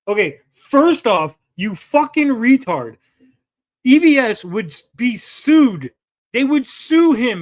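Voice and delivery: loud, high, slow and emphatic; exasperated rant. Real, not acted. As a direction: berate him word by heavy word. Exasperated rant at the tweet's author.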